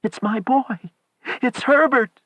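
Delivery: high, frantic shout. A narrator's reading. Wife struggles with husband over son, frantic shout. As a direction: shout it while struggling to get free. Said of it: "she shouted and struggled with her husband".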